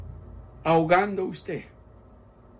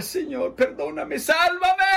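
cry for help; 11 semitones higher, 3 dB louder than usual. Acted. Preacher acting a sinking man crying to the Lord, building to a shout.

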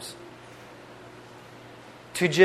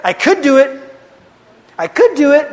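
emphatic David boast; 7 semitones higher, 6 dB louder than usual. Retold or imitated. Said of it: "you know what David said".

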